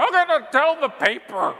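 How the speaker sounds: high, loud mock scream of a leaving customer; imitating a scream. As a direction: screech the threat as she storms out. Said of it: "She screams out of there".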